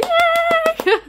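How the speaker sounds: squeaky high chipmunk cheer. Retold or imitated. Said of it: "tell me off for the, um, chipmunk".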